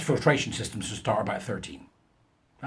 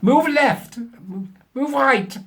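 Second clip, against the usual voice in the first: put-on much higher, louder, slow German accent; mock German professor; 13 semitones higher, 7 dB louder, much slower than usual. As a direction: ham up a self-important German scientist. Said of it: "of German extraction".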